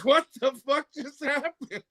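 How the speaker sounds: high, drawn-out bafflement; slow, incredulous high voice; retold disbelief. Retelling baffled reaction to cop leaving.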